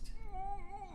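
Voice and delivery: quiet gloating chuckle, high; a small cruel laugh to yourself; soft villain laugh. Acted. Villain gloating over captive.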